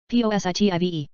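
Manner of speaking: quick letter run; brisk recap spelling; fast spelling. Shown as a demonstration. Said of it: "spelling drill, slow then fast".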